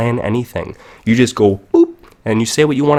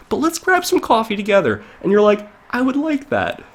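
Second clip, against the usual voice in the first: sweet agreement; 11 semitones higher than usual. Acted. Two friends agreeing on coffee.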